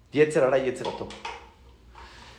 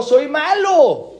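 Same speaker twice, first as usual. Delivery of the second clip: mock guilty confession; 8 semitones higher, 4 dB louder, much slower than usual. Retelling a man's confession.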